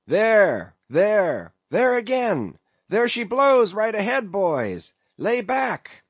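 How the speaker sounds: high, loud whale-sighting cry; excited calling out. A narrator's reading. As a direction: yell to the rowers, the whale is ahead. Mate urging crew at whale.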